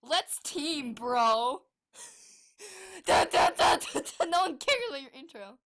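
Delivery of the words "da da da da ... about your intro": ordinary talk, nothing marked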